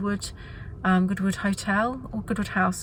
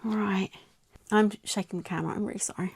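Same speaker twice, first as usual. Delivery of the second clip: normal talking voice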